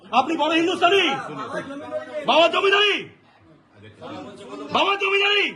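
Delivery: real indignant yell; angry taunt. Real, not acted. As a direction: throw it at the clerk as a heated challenge. Angry taunts at the railway clerk.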